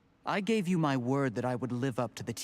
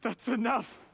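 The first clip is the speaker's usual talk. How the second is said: higher-pitched, desperate cry to stop; anguished outburst; 9 semitones higher than usual. Acted. Cornered man stops his accuser, anguished outburst. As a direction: cut her off, pitch jumping up.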